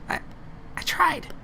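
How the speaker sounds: tiny high voice, softly; high-pitched creature. Acted. Creature explains the barrier to companion, high and small. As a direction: squeak out the excuse. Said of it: "She goes".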